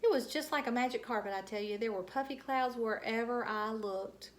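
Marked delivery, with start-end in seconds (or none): none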